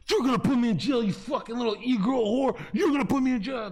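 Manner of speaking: loud, high angry yelling; yelling at her. Retold or imitated. Yelling at his girlfriend during a fight, loud and high. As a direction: scream the accusation at her. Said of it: "I kept saying to her".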